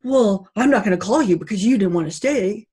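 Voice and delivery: loud, indignant outburst; imitating a date who flips out; flipping out. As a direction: snap it back, offended and loud. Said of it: "flips out and goes".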